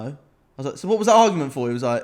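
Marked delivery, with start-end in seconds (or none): 0.8-1.7 higher and louder, in mock disbelief; exasperated retold question.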